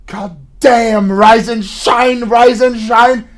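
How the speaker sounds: loud, drawn-out imitation of mother's wake-up call; mocking yell. Son imitating his mother's morning call, yelling mockingly. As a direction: throw her yelling back at her. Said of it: "you come in yelling at me".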